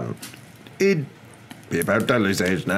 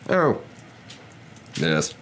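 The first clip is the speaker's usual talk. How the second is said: dismayed, halting agreement; sinking heart. Acted. Husband gives in to his wife, heart sinking. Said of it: "his heart sinking horribly".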